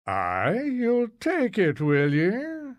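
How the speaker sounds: slow, swooping high Scots question; Scottish landlady, surprised. Acted. Landlady questions the new tenant's quick decision, surprised and drawn out. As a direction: draw it out, rising high in mild surprise.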